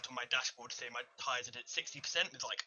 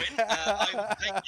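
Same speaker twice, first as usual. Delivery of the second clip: laughing; 7 semitones higher, 10 dB louder than usual. Real, not acted. Joking birthday present request.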